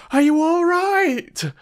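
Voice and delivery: high, loud anxious cry; cried out. Acted. Young traveller calling to friend on path, high alarmed cry. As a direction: call down in alarm to his friend. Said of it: "he cried".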